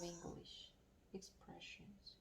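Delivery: whispered word. Real, not acted. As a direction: drop to a whisper on the last word. Trails off mid-answer.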